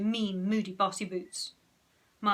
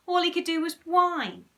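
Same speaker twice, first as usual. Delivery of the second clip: ordinary delivery, nothing marked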